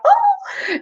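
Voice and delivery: a sudden squeak of fright; startled gasp. Retold or imitated. Telmarines seeing trees rush at them, startled high cry. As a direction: leap up in alarm on Oh. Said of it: "they were like".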